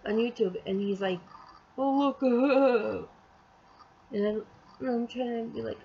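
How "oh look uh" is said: drawn-out, higher mock voice; mocking imitation. Imitating him over truck videos, drawn-out mockery.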